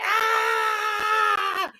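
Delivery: a high, hammed-up cry of pain; mock pained yell. Patient getting bone marrow drawn, mock pained yell. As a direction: play the needle going in. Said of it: "He was in there like".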